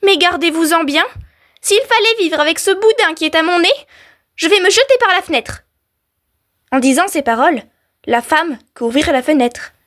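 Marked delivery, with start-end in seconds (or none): 0.0-5.6 high-pitched indignant warning; upset storybook wife; threatening wife. A narrator's reading. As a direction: warn your husband off in acted dismay. Wife threatens her husband.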